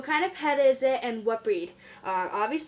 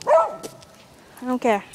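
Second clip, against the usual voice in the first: voicing the dog; 8 dB louder, much slower than usual.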